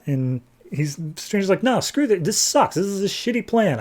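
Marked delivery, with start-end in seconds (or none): 1.6-3.8 higher-pitched, whiny-style objection; mock complaint. Stranger rejecting God's plan, mock exasperated. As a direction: imitate an exasperated refusal.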